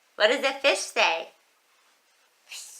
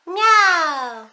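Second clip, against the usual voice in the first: high meowing cat; 7 semitones higher, 4 dB louder than usual. Shown as a demonstration. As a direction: meow like a kitty.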